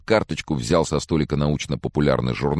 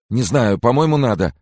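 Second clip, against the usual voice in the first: agitated reply; 9 semitones higher, 6 dB louder than usual. A narrator's reading. Agitated friend answers in dialogue.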